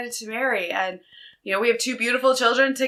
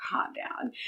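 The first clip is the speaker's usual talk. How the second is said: whispered calm-down; 10 dB quieter, slower than usual. Retold or imitated. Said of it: "And he's like".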